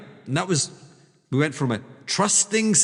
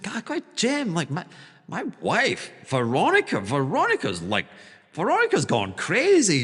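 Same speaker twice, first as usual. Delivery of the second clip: mock frantic broker; 3 semitones higher than usual. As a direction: pitch up and play the broker's alarm. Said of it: "his broker's like".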